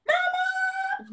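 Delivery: put-on a toddler calling for mum, very high; small child calling. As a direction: call mum like a tiny kid.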